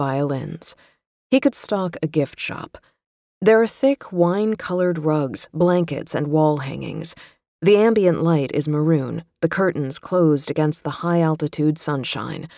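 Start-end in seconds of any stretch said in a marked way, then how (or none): none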